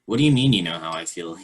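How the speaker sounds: ordinary delivery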